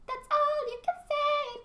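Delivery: very high squeaky cartoon girl; Dee Dee impression. Dee Dee teasing her brother, very high cartoon squeal. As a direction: squeal like a bratty little sister. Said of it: "I love Dee Dee".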